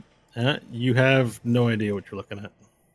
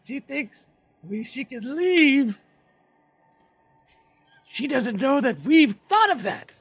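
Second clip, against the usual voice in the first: high, slow, louder menace; gloating creature voice; 7 dB louder, slower than usual. Acted. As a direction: taunt them, they're trapped.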